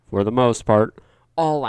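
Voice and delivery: "for the most part" punched out loud; loud emphasis. Real, not acted. Repeats the phrase louder.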